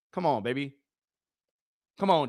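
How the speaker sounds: usual speaking voice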